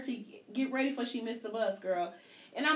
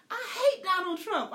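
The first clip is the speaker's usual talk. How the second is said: mock kid; 9 semitones higher, 3 dB louder, slower than usual. Repeating the daughter's line.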